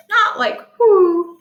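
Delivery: slow mock owl hoot; owl's hoot. Shown as a demonstration. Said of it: "the sound that the owl makes".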